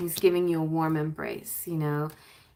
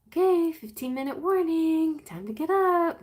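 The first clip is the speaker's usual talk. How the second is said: high, bright sing-song countdown; cheerful wake-up warning; 9 semitones higher than usual. Retold or imitated. Mother giving the sleepy kid a countdown, high and cheery. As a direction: announce it sweetly but firmly. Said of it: "or I'll start with ten".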